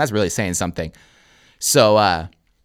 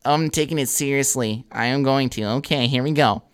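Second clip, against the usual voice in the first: put-on higher stoner kid voice, insisting; stoned Matt McKay; 4 semitones higher than usual. Student assuring his teacher he is serious, stoner voice. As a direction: protest as a stoned student would. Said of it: "And he goes".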